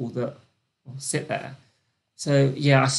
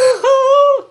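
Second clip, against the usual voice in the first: very high, loud giggle; squealing laugh; 10 dB louder than usual. Real, not acted. Host laughing at her own drama, high squeal. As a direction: burst out laughing at yourself.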